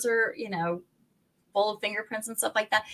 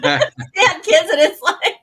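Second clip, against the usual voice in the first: laughing through the words; giggling as she says it; 10 semitones higher, 10 dB louder, slower than usual. Real, not acted. Laughing at sticky door handles.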